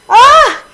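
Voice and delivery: very high, loud startled scream; freaked-out yelp. Real, not acted. Streamer panicking at her exploding ship, loud yelp. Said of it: "do you ever do that when you're freaked out?".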